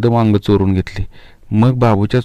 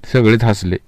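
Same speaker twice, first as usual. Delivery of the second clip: ordinary delivery, nothing marked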